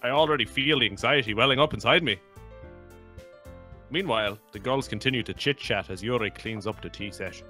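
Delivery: put-on light Irish accent reading. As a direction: read it in an Irish lilt. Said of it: "it's hard to talk in an Irish accent".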